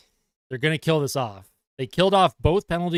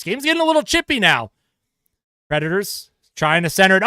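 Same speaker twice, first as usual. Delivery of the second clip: brisk hockey play-by-play call; sportscaster calling the action; 2 semitones higher, 4 dB louder than usual. Acted. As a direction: call the game live as it happens. Live play-by-play of hockey game.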